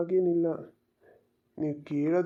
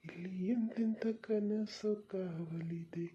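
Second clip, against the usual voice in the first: whisper; 7 dB quieter than usual. Acted. Romantic verse whispered to her.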